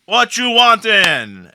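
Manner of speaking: put-on loud, slow, high Jamaican accent; Jamaican man. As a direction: call it out in stretched-out Jamaican. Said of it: "this large Jamaican man".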